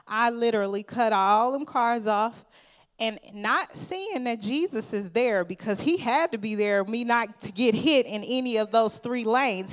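loud, driving sermon; preaching at full force. Acted. Preaching climax.

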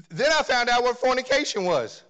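high, loud, rising revelation; preaching excitement. Real, not acted. Preacher telling congregation his prison discovery, excited and loud. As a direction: let it burst out in astonishment.

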